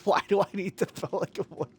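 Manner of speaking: soft, breaking up with laughter; laughing disbelief. Real, not acted. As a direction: try to ask through real giggles. Cracks up retelling his disbelief.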